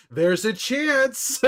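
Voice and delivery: high, slow teasing delivery; mock sly actor. Streamer imitating Pedro on the red carpet, slow and teasing. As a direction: draw it out slyly. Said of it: "he's got this look on his face where he's like".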